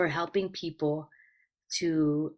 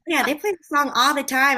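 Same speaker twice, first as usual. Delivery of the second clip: excited quote; 9 semitones higher, 9 dB louder than usual. Retold or imitated. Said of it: "I was like".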